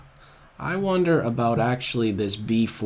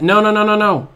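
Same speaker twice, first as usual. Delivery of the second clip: loud, higher run of no's; panicked no; 6 semitones higher, 9 dB louder, faster than usual. Real, not acted. Player seeing a threat, loud panicked no's. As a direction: stop it before it happens, loud.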